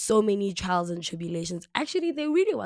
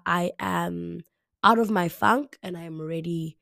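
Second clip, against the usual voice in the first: ordinary delivery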